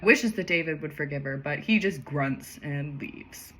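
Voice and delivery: ordinary delivery, nothing marked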